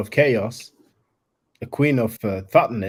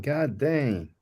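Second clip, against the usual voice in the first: ordinary talk, nothing marked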